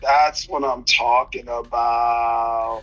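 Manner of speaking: low, slow and drawn out, leaning on every word; slow emphatic repeat. Real, not acted. Fighter to podcast host, slow low emphatic repeat. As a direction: let it sink in, slow and low.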